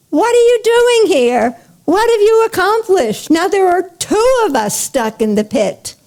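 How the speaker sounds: high-pitched, aghast questioning; acted exasperated outburst, starting very high; surprised dismay. A narrator's reading. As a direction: demand an answer in disbelief and dismay. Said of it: "to his surprise and dismay".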